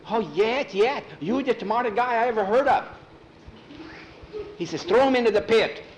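high, excited egging-on; urgent persuading boys. Acted. Boys urging Thor against the skull, urgent. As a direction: flatter and goad him urgently.

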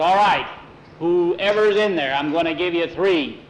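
loud, deliberate threat; stern warning. A narrator's reading. Tom, armed, warns whoever hides in the closet, stern warning. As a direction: warn the hidden intruders loudly and firmly.

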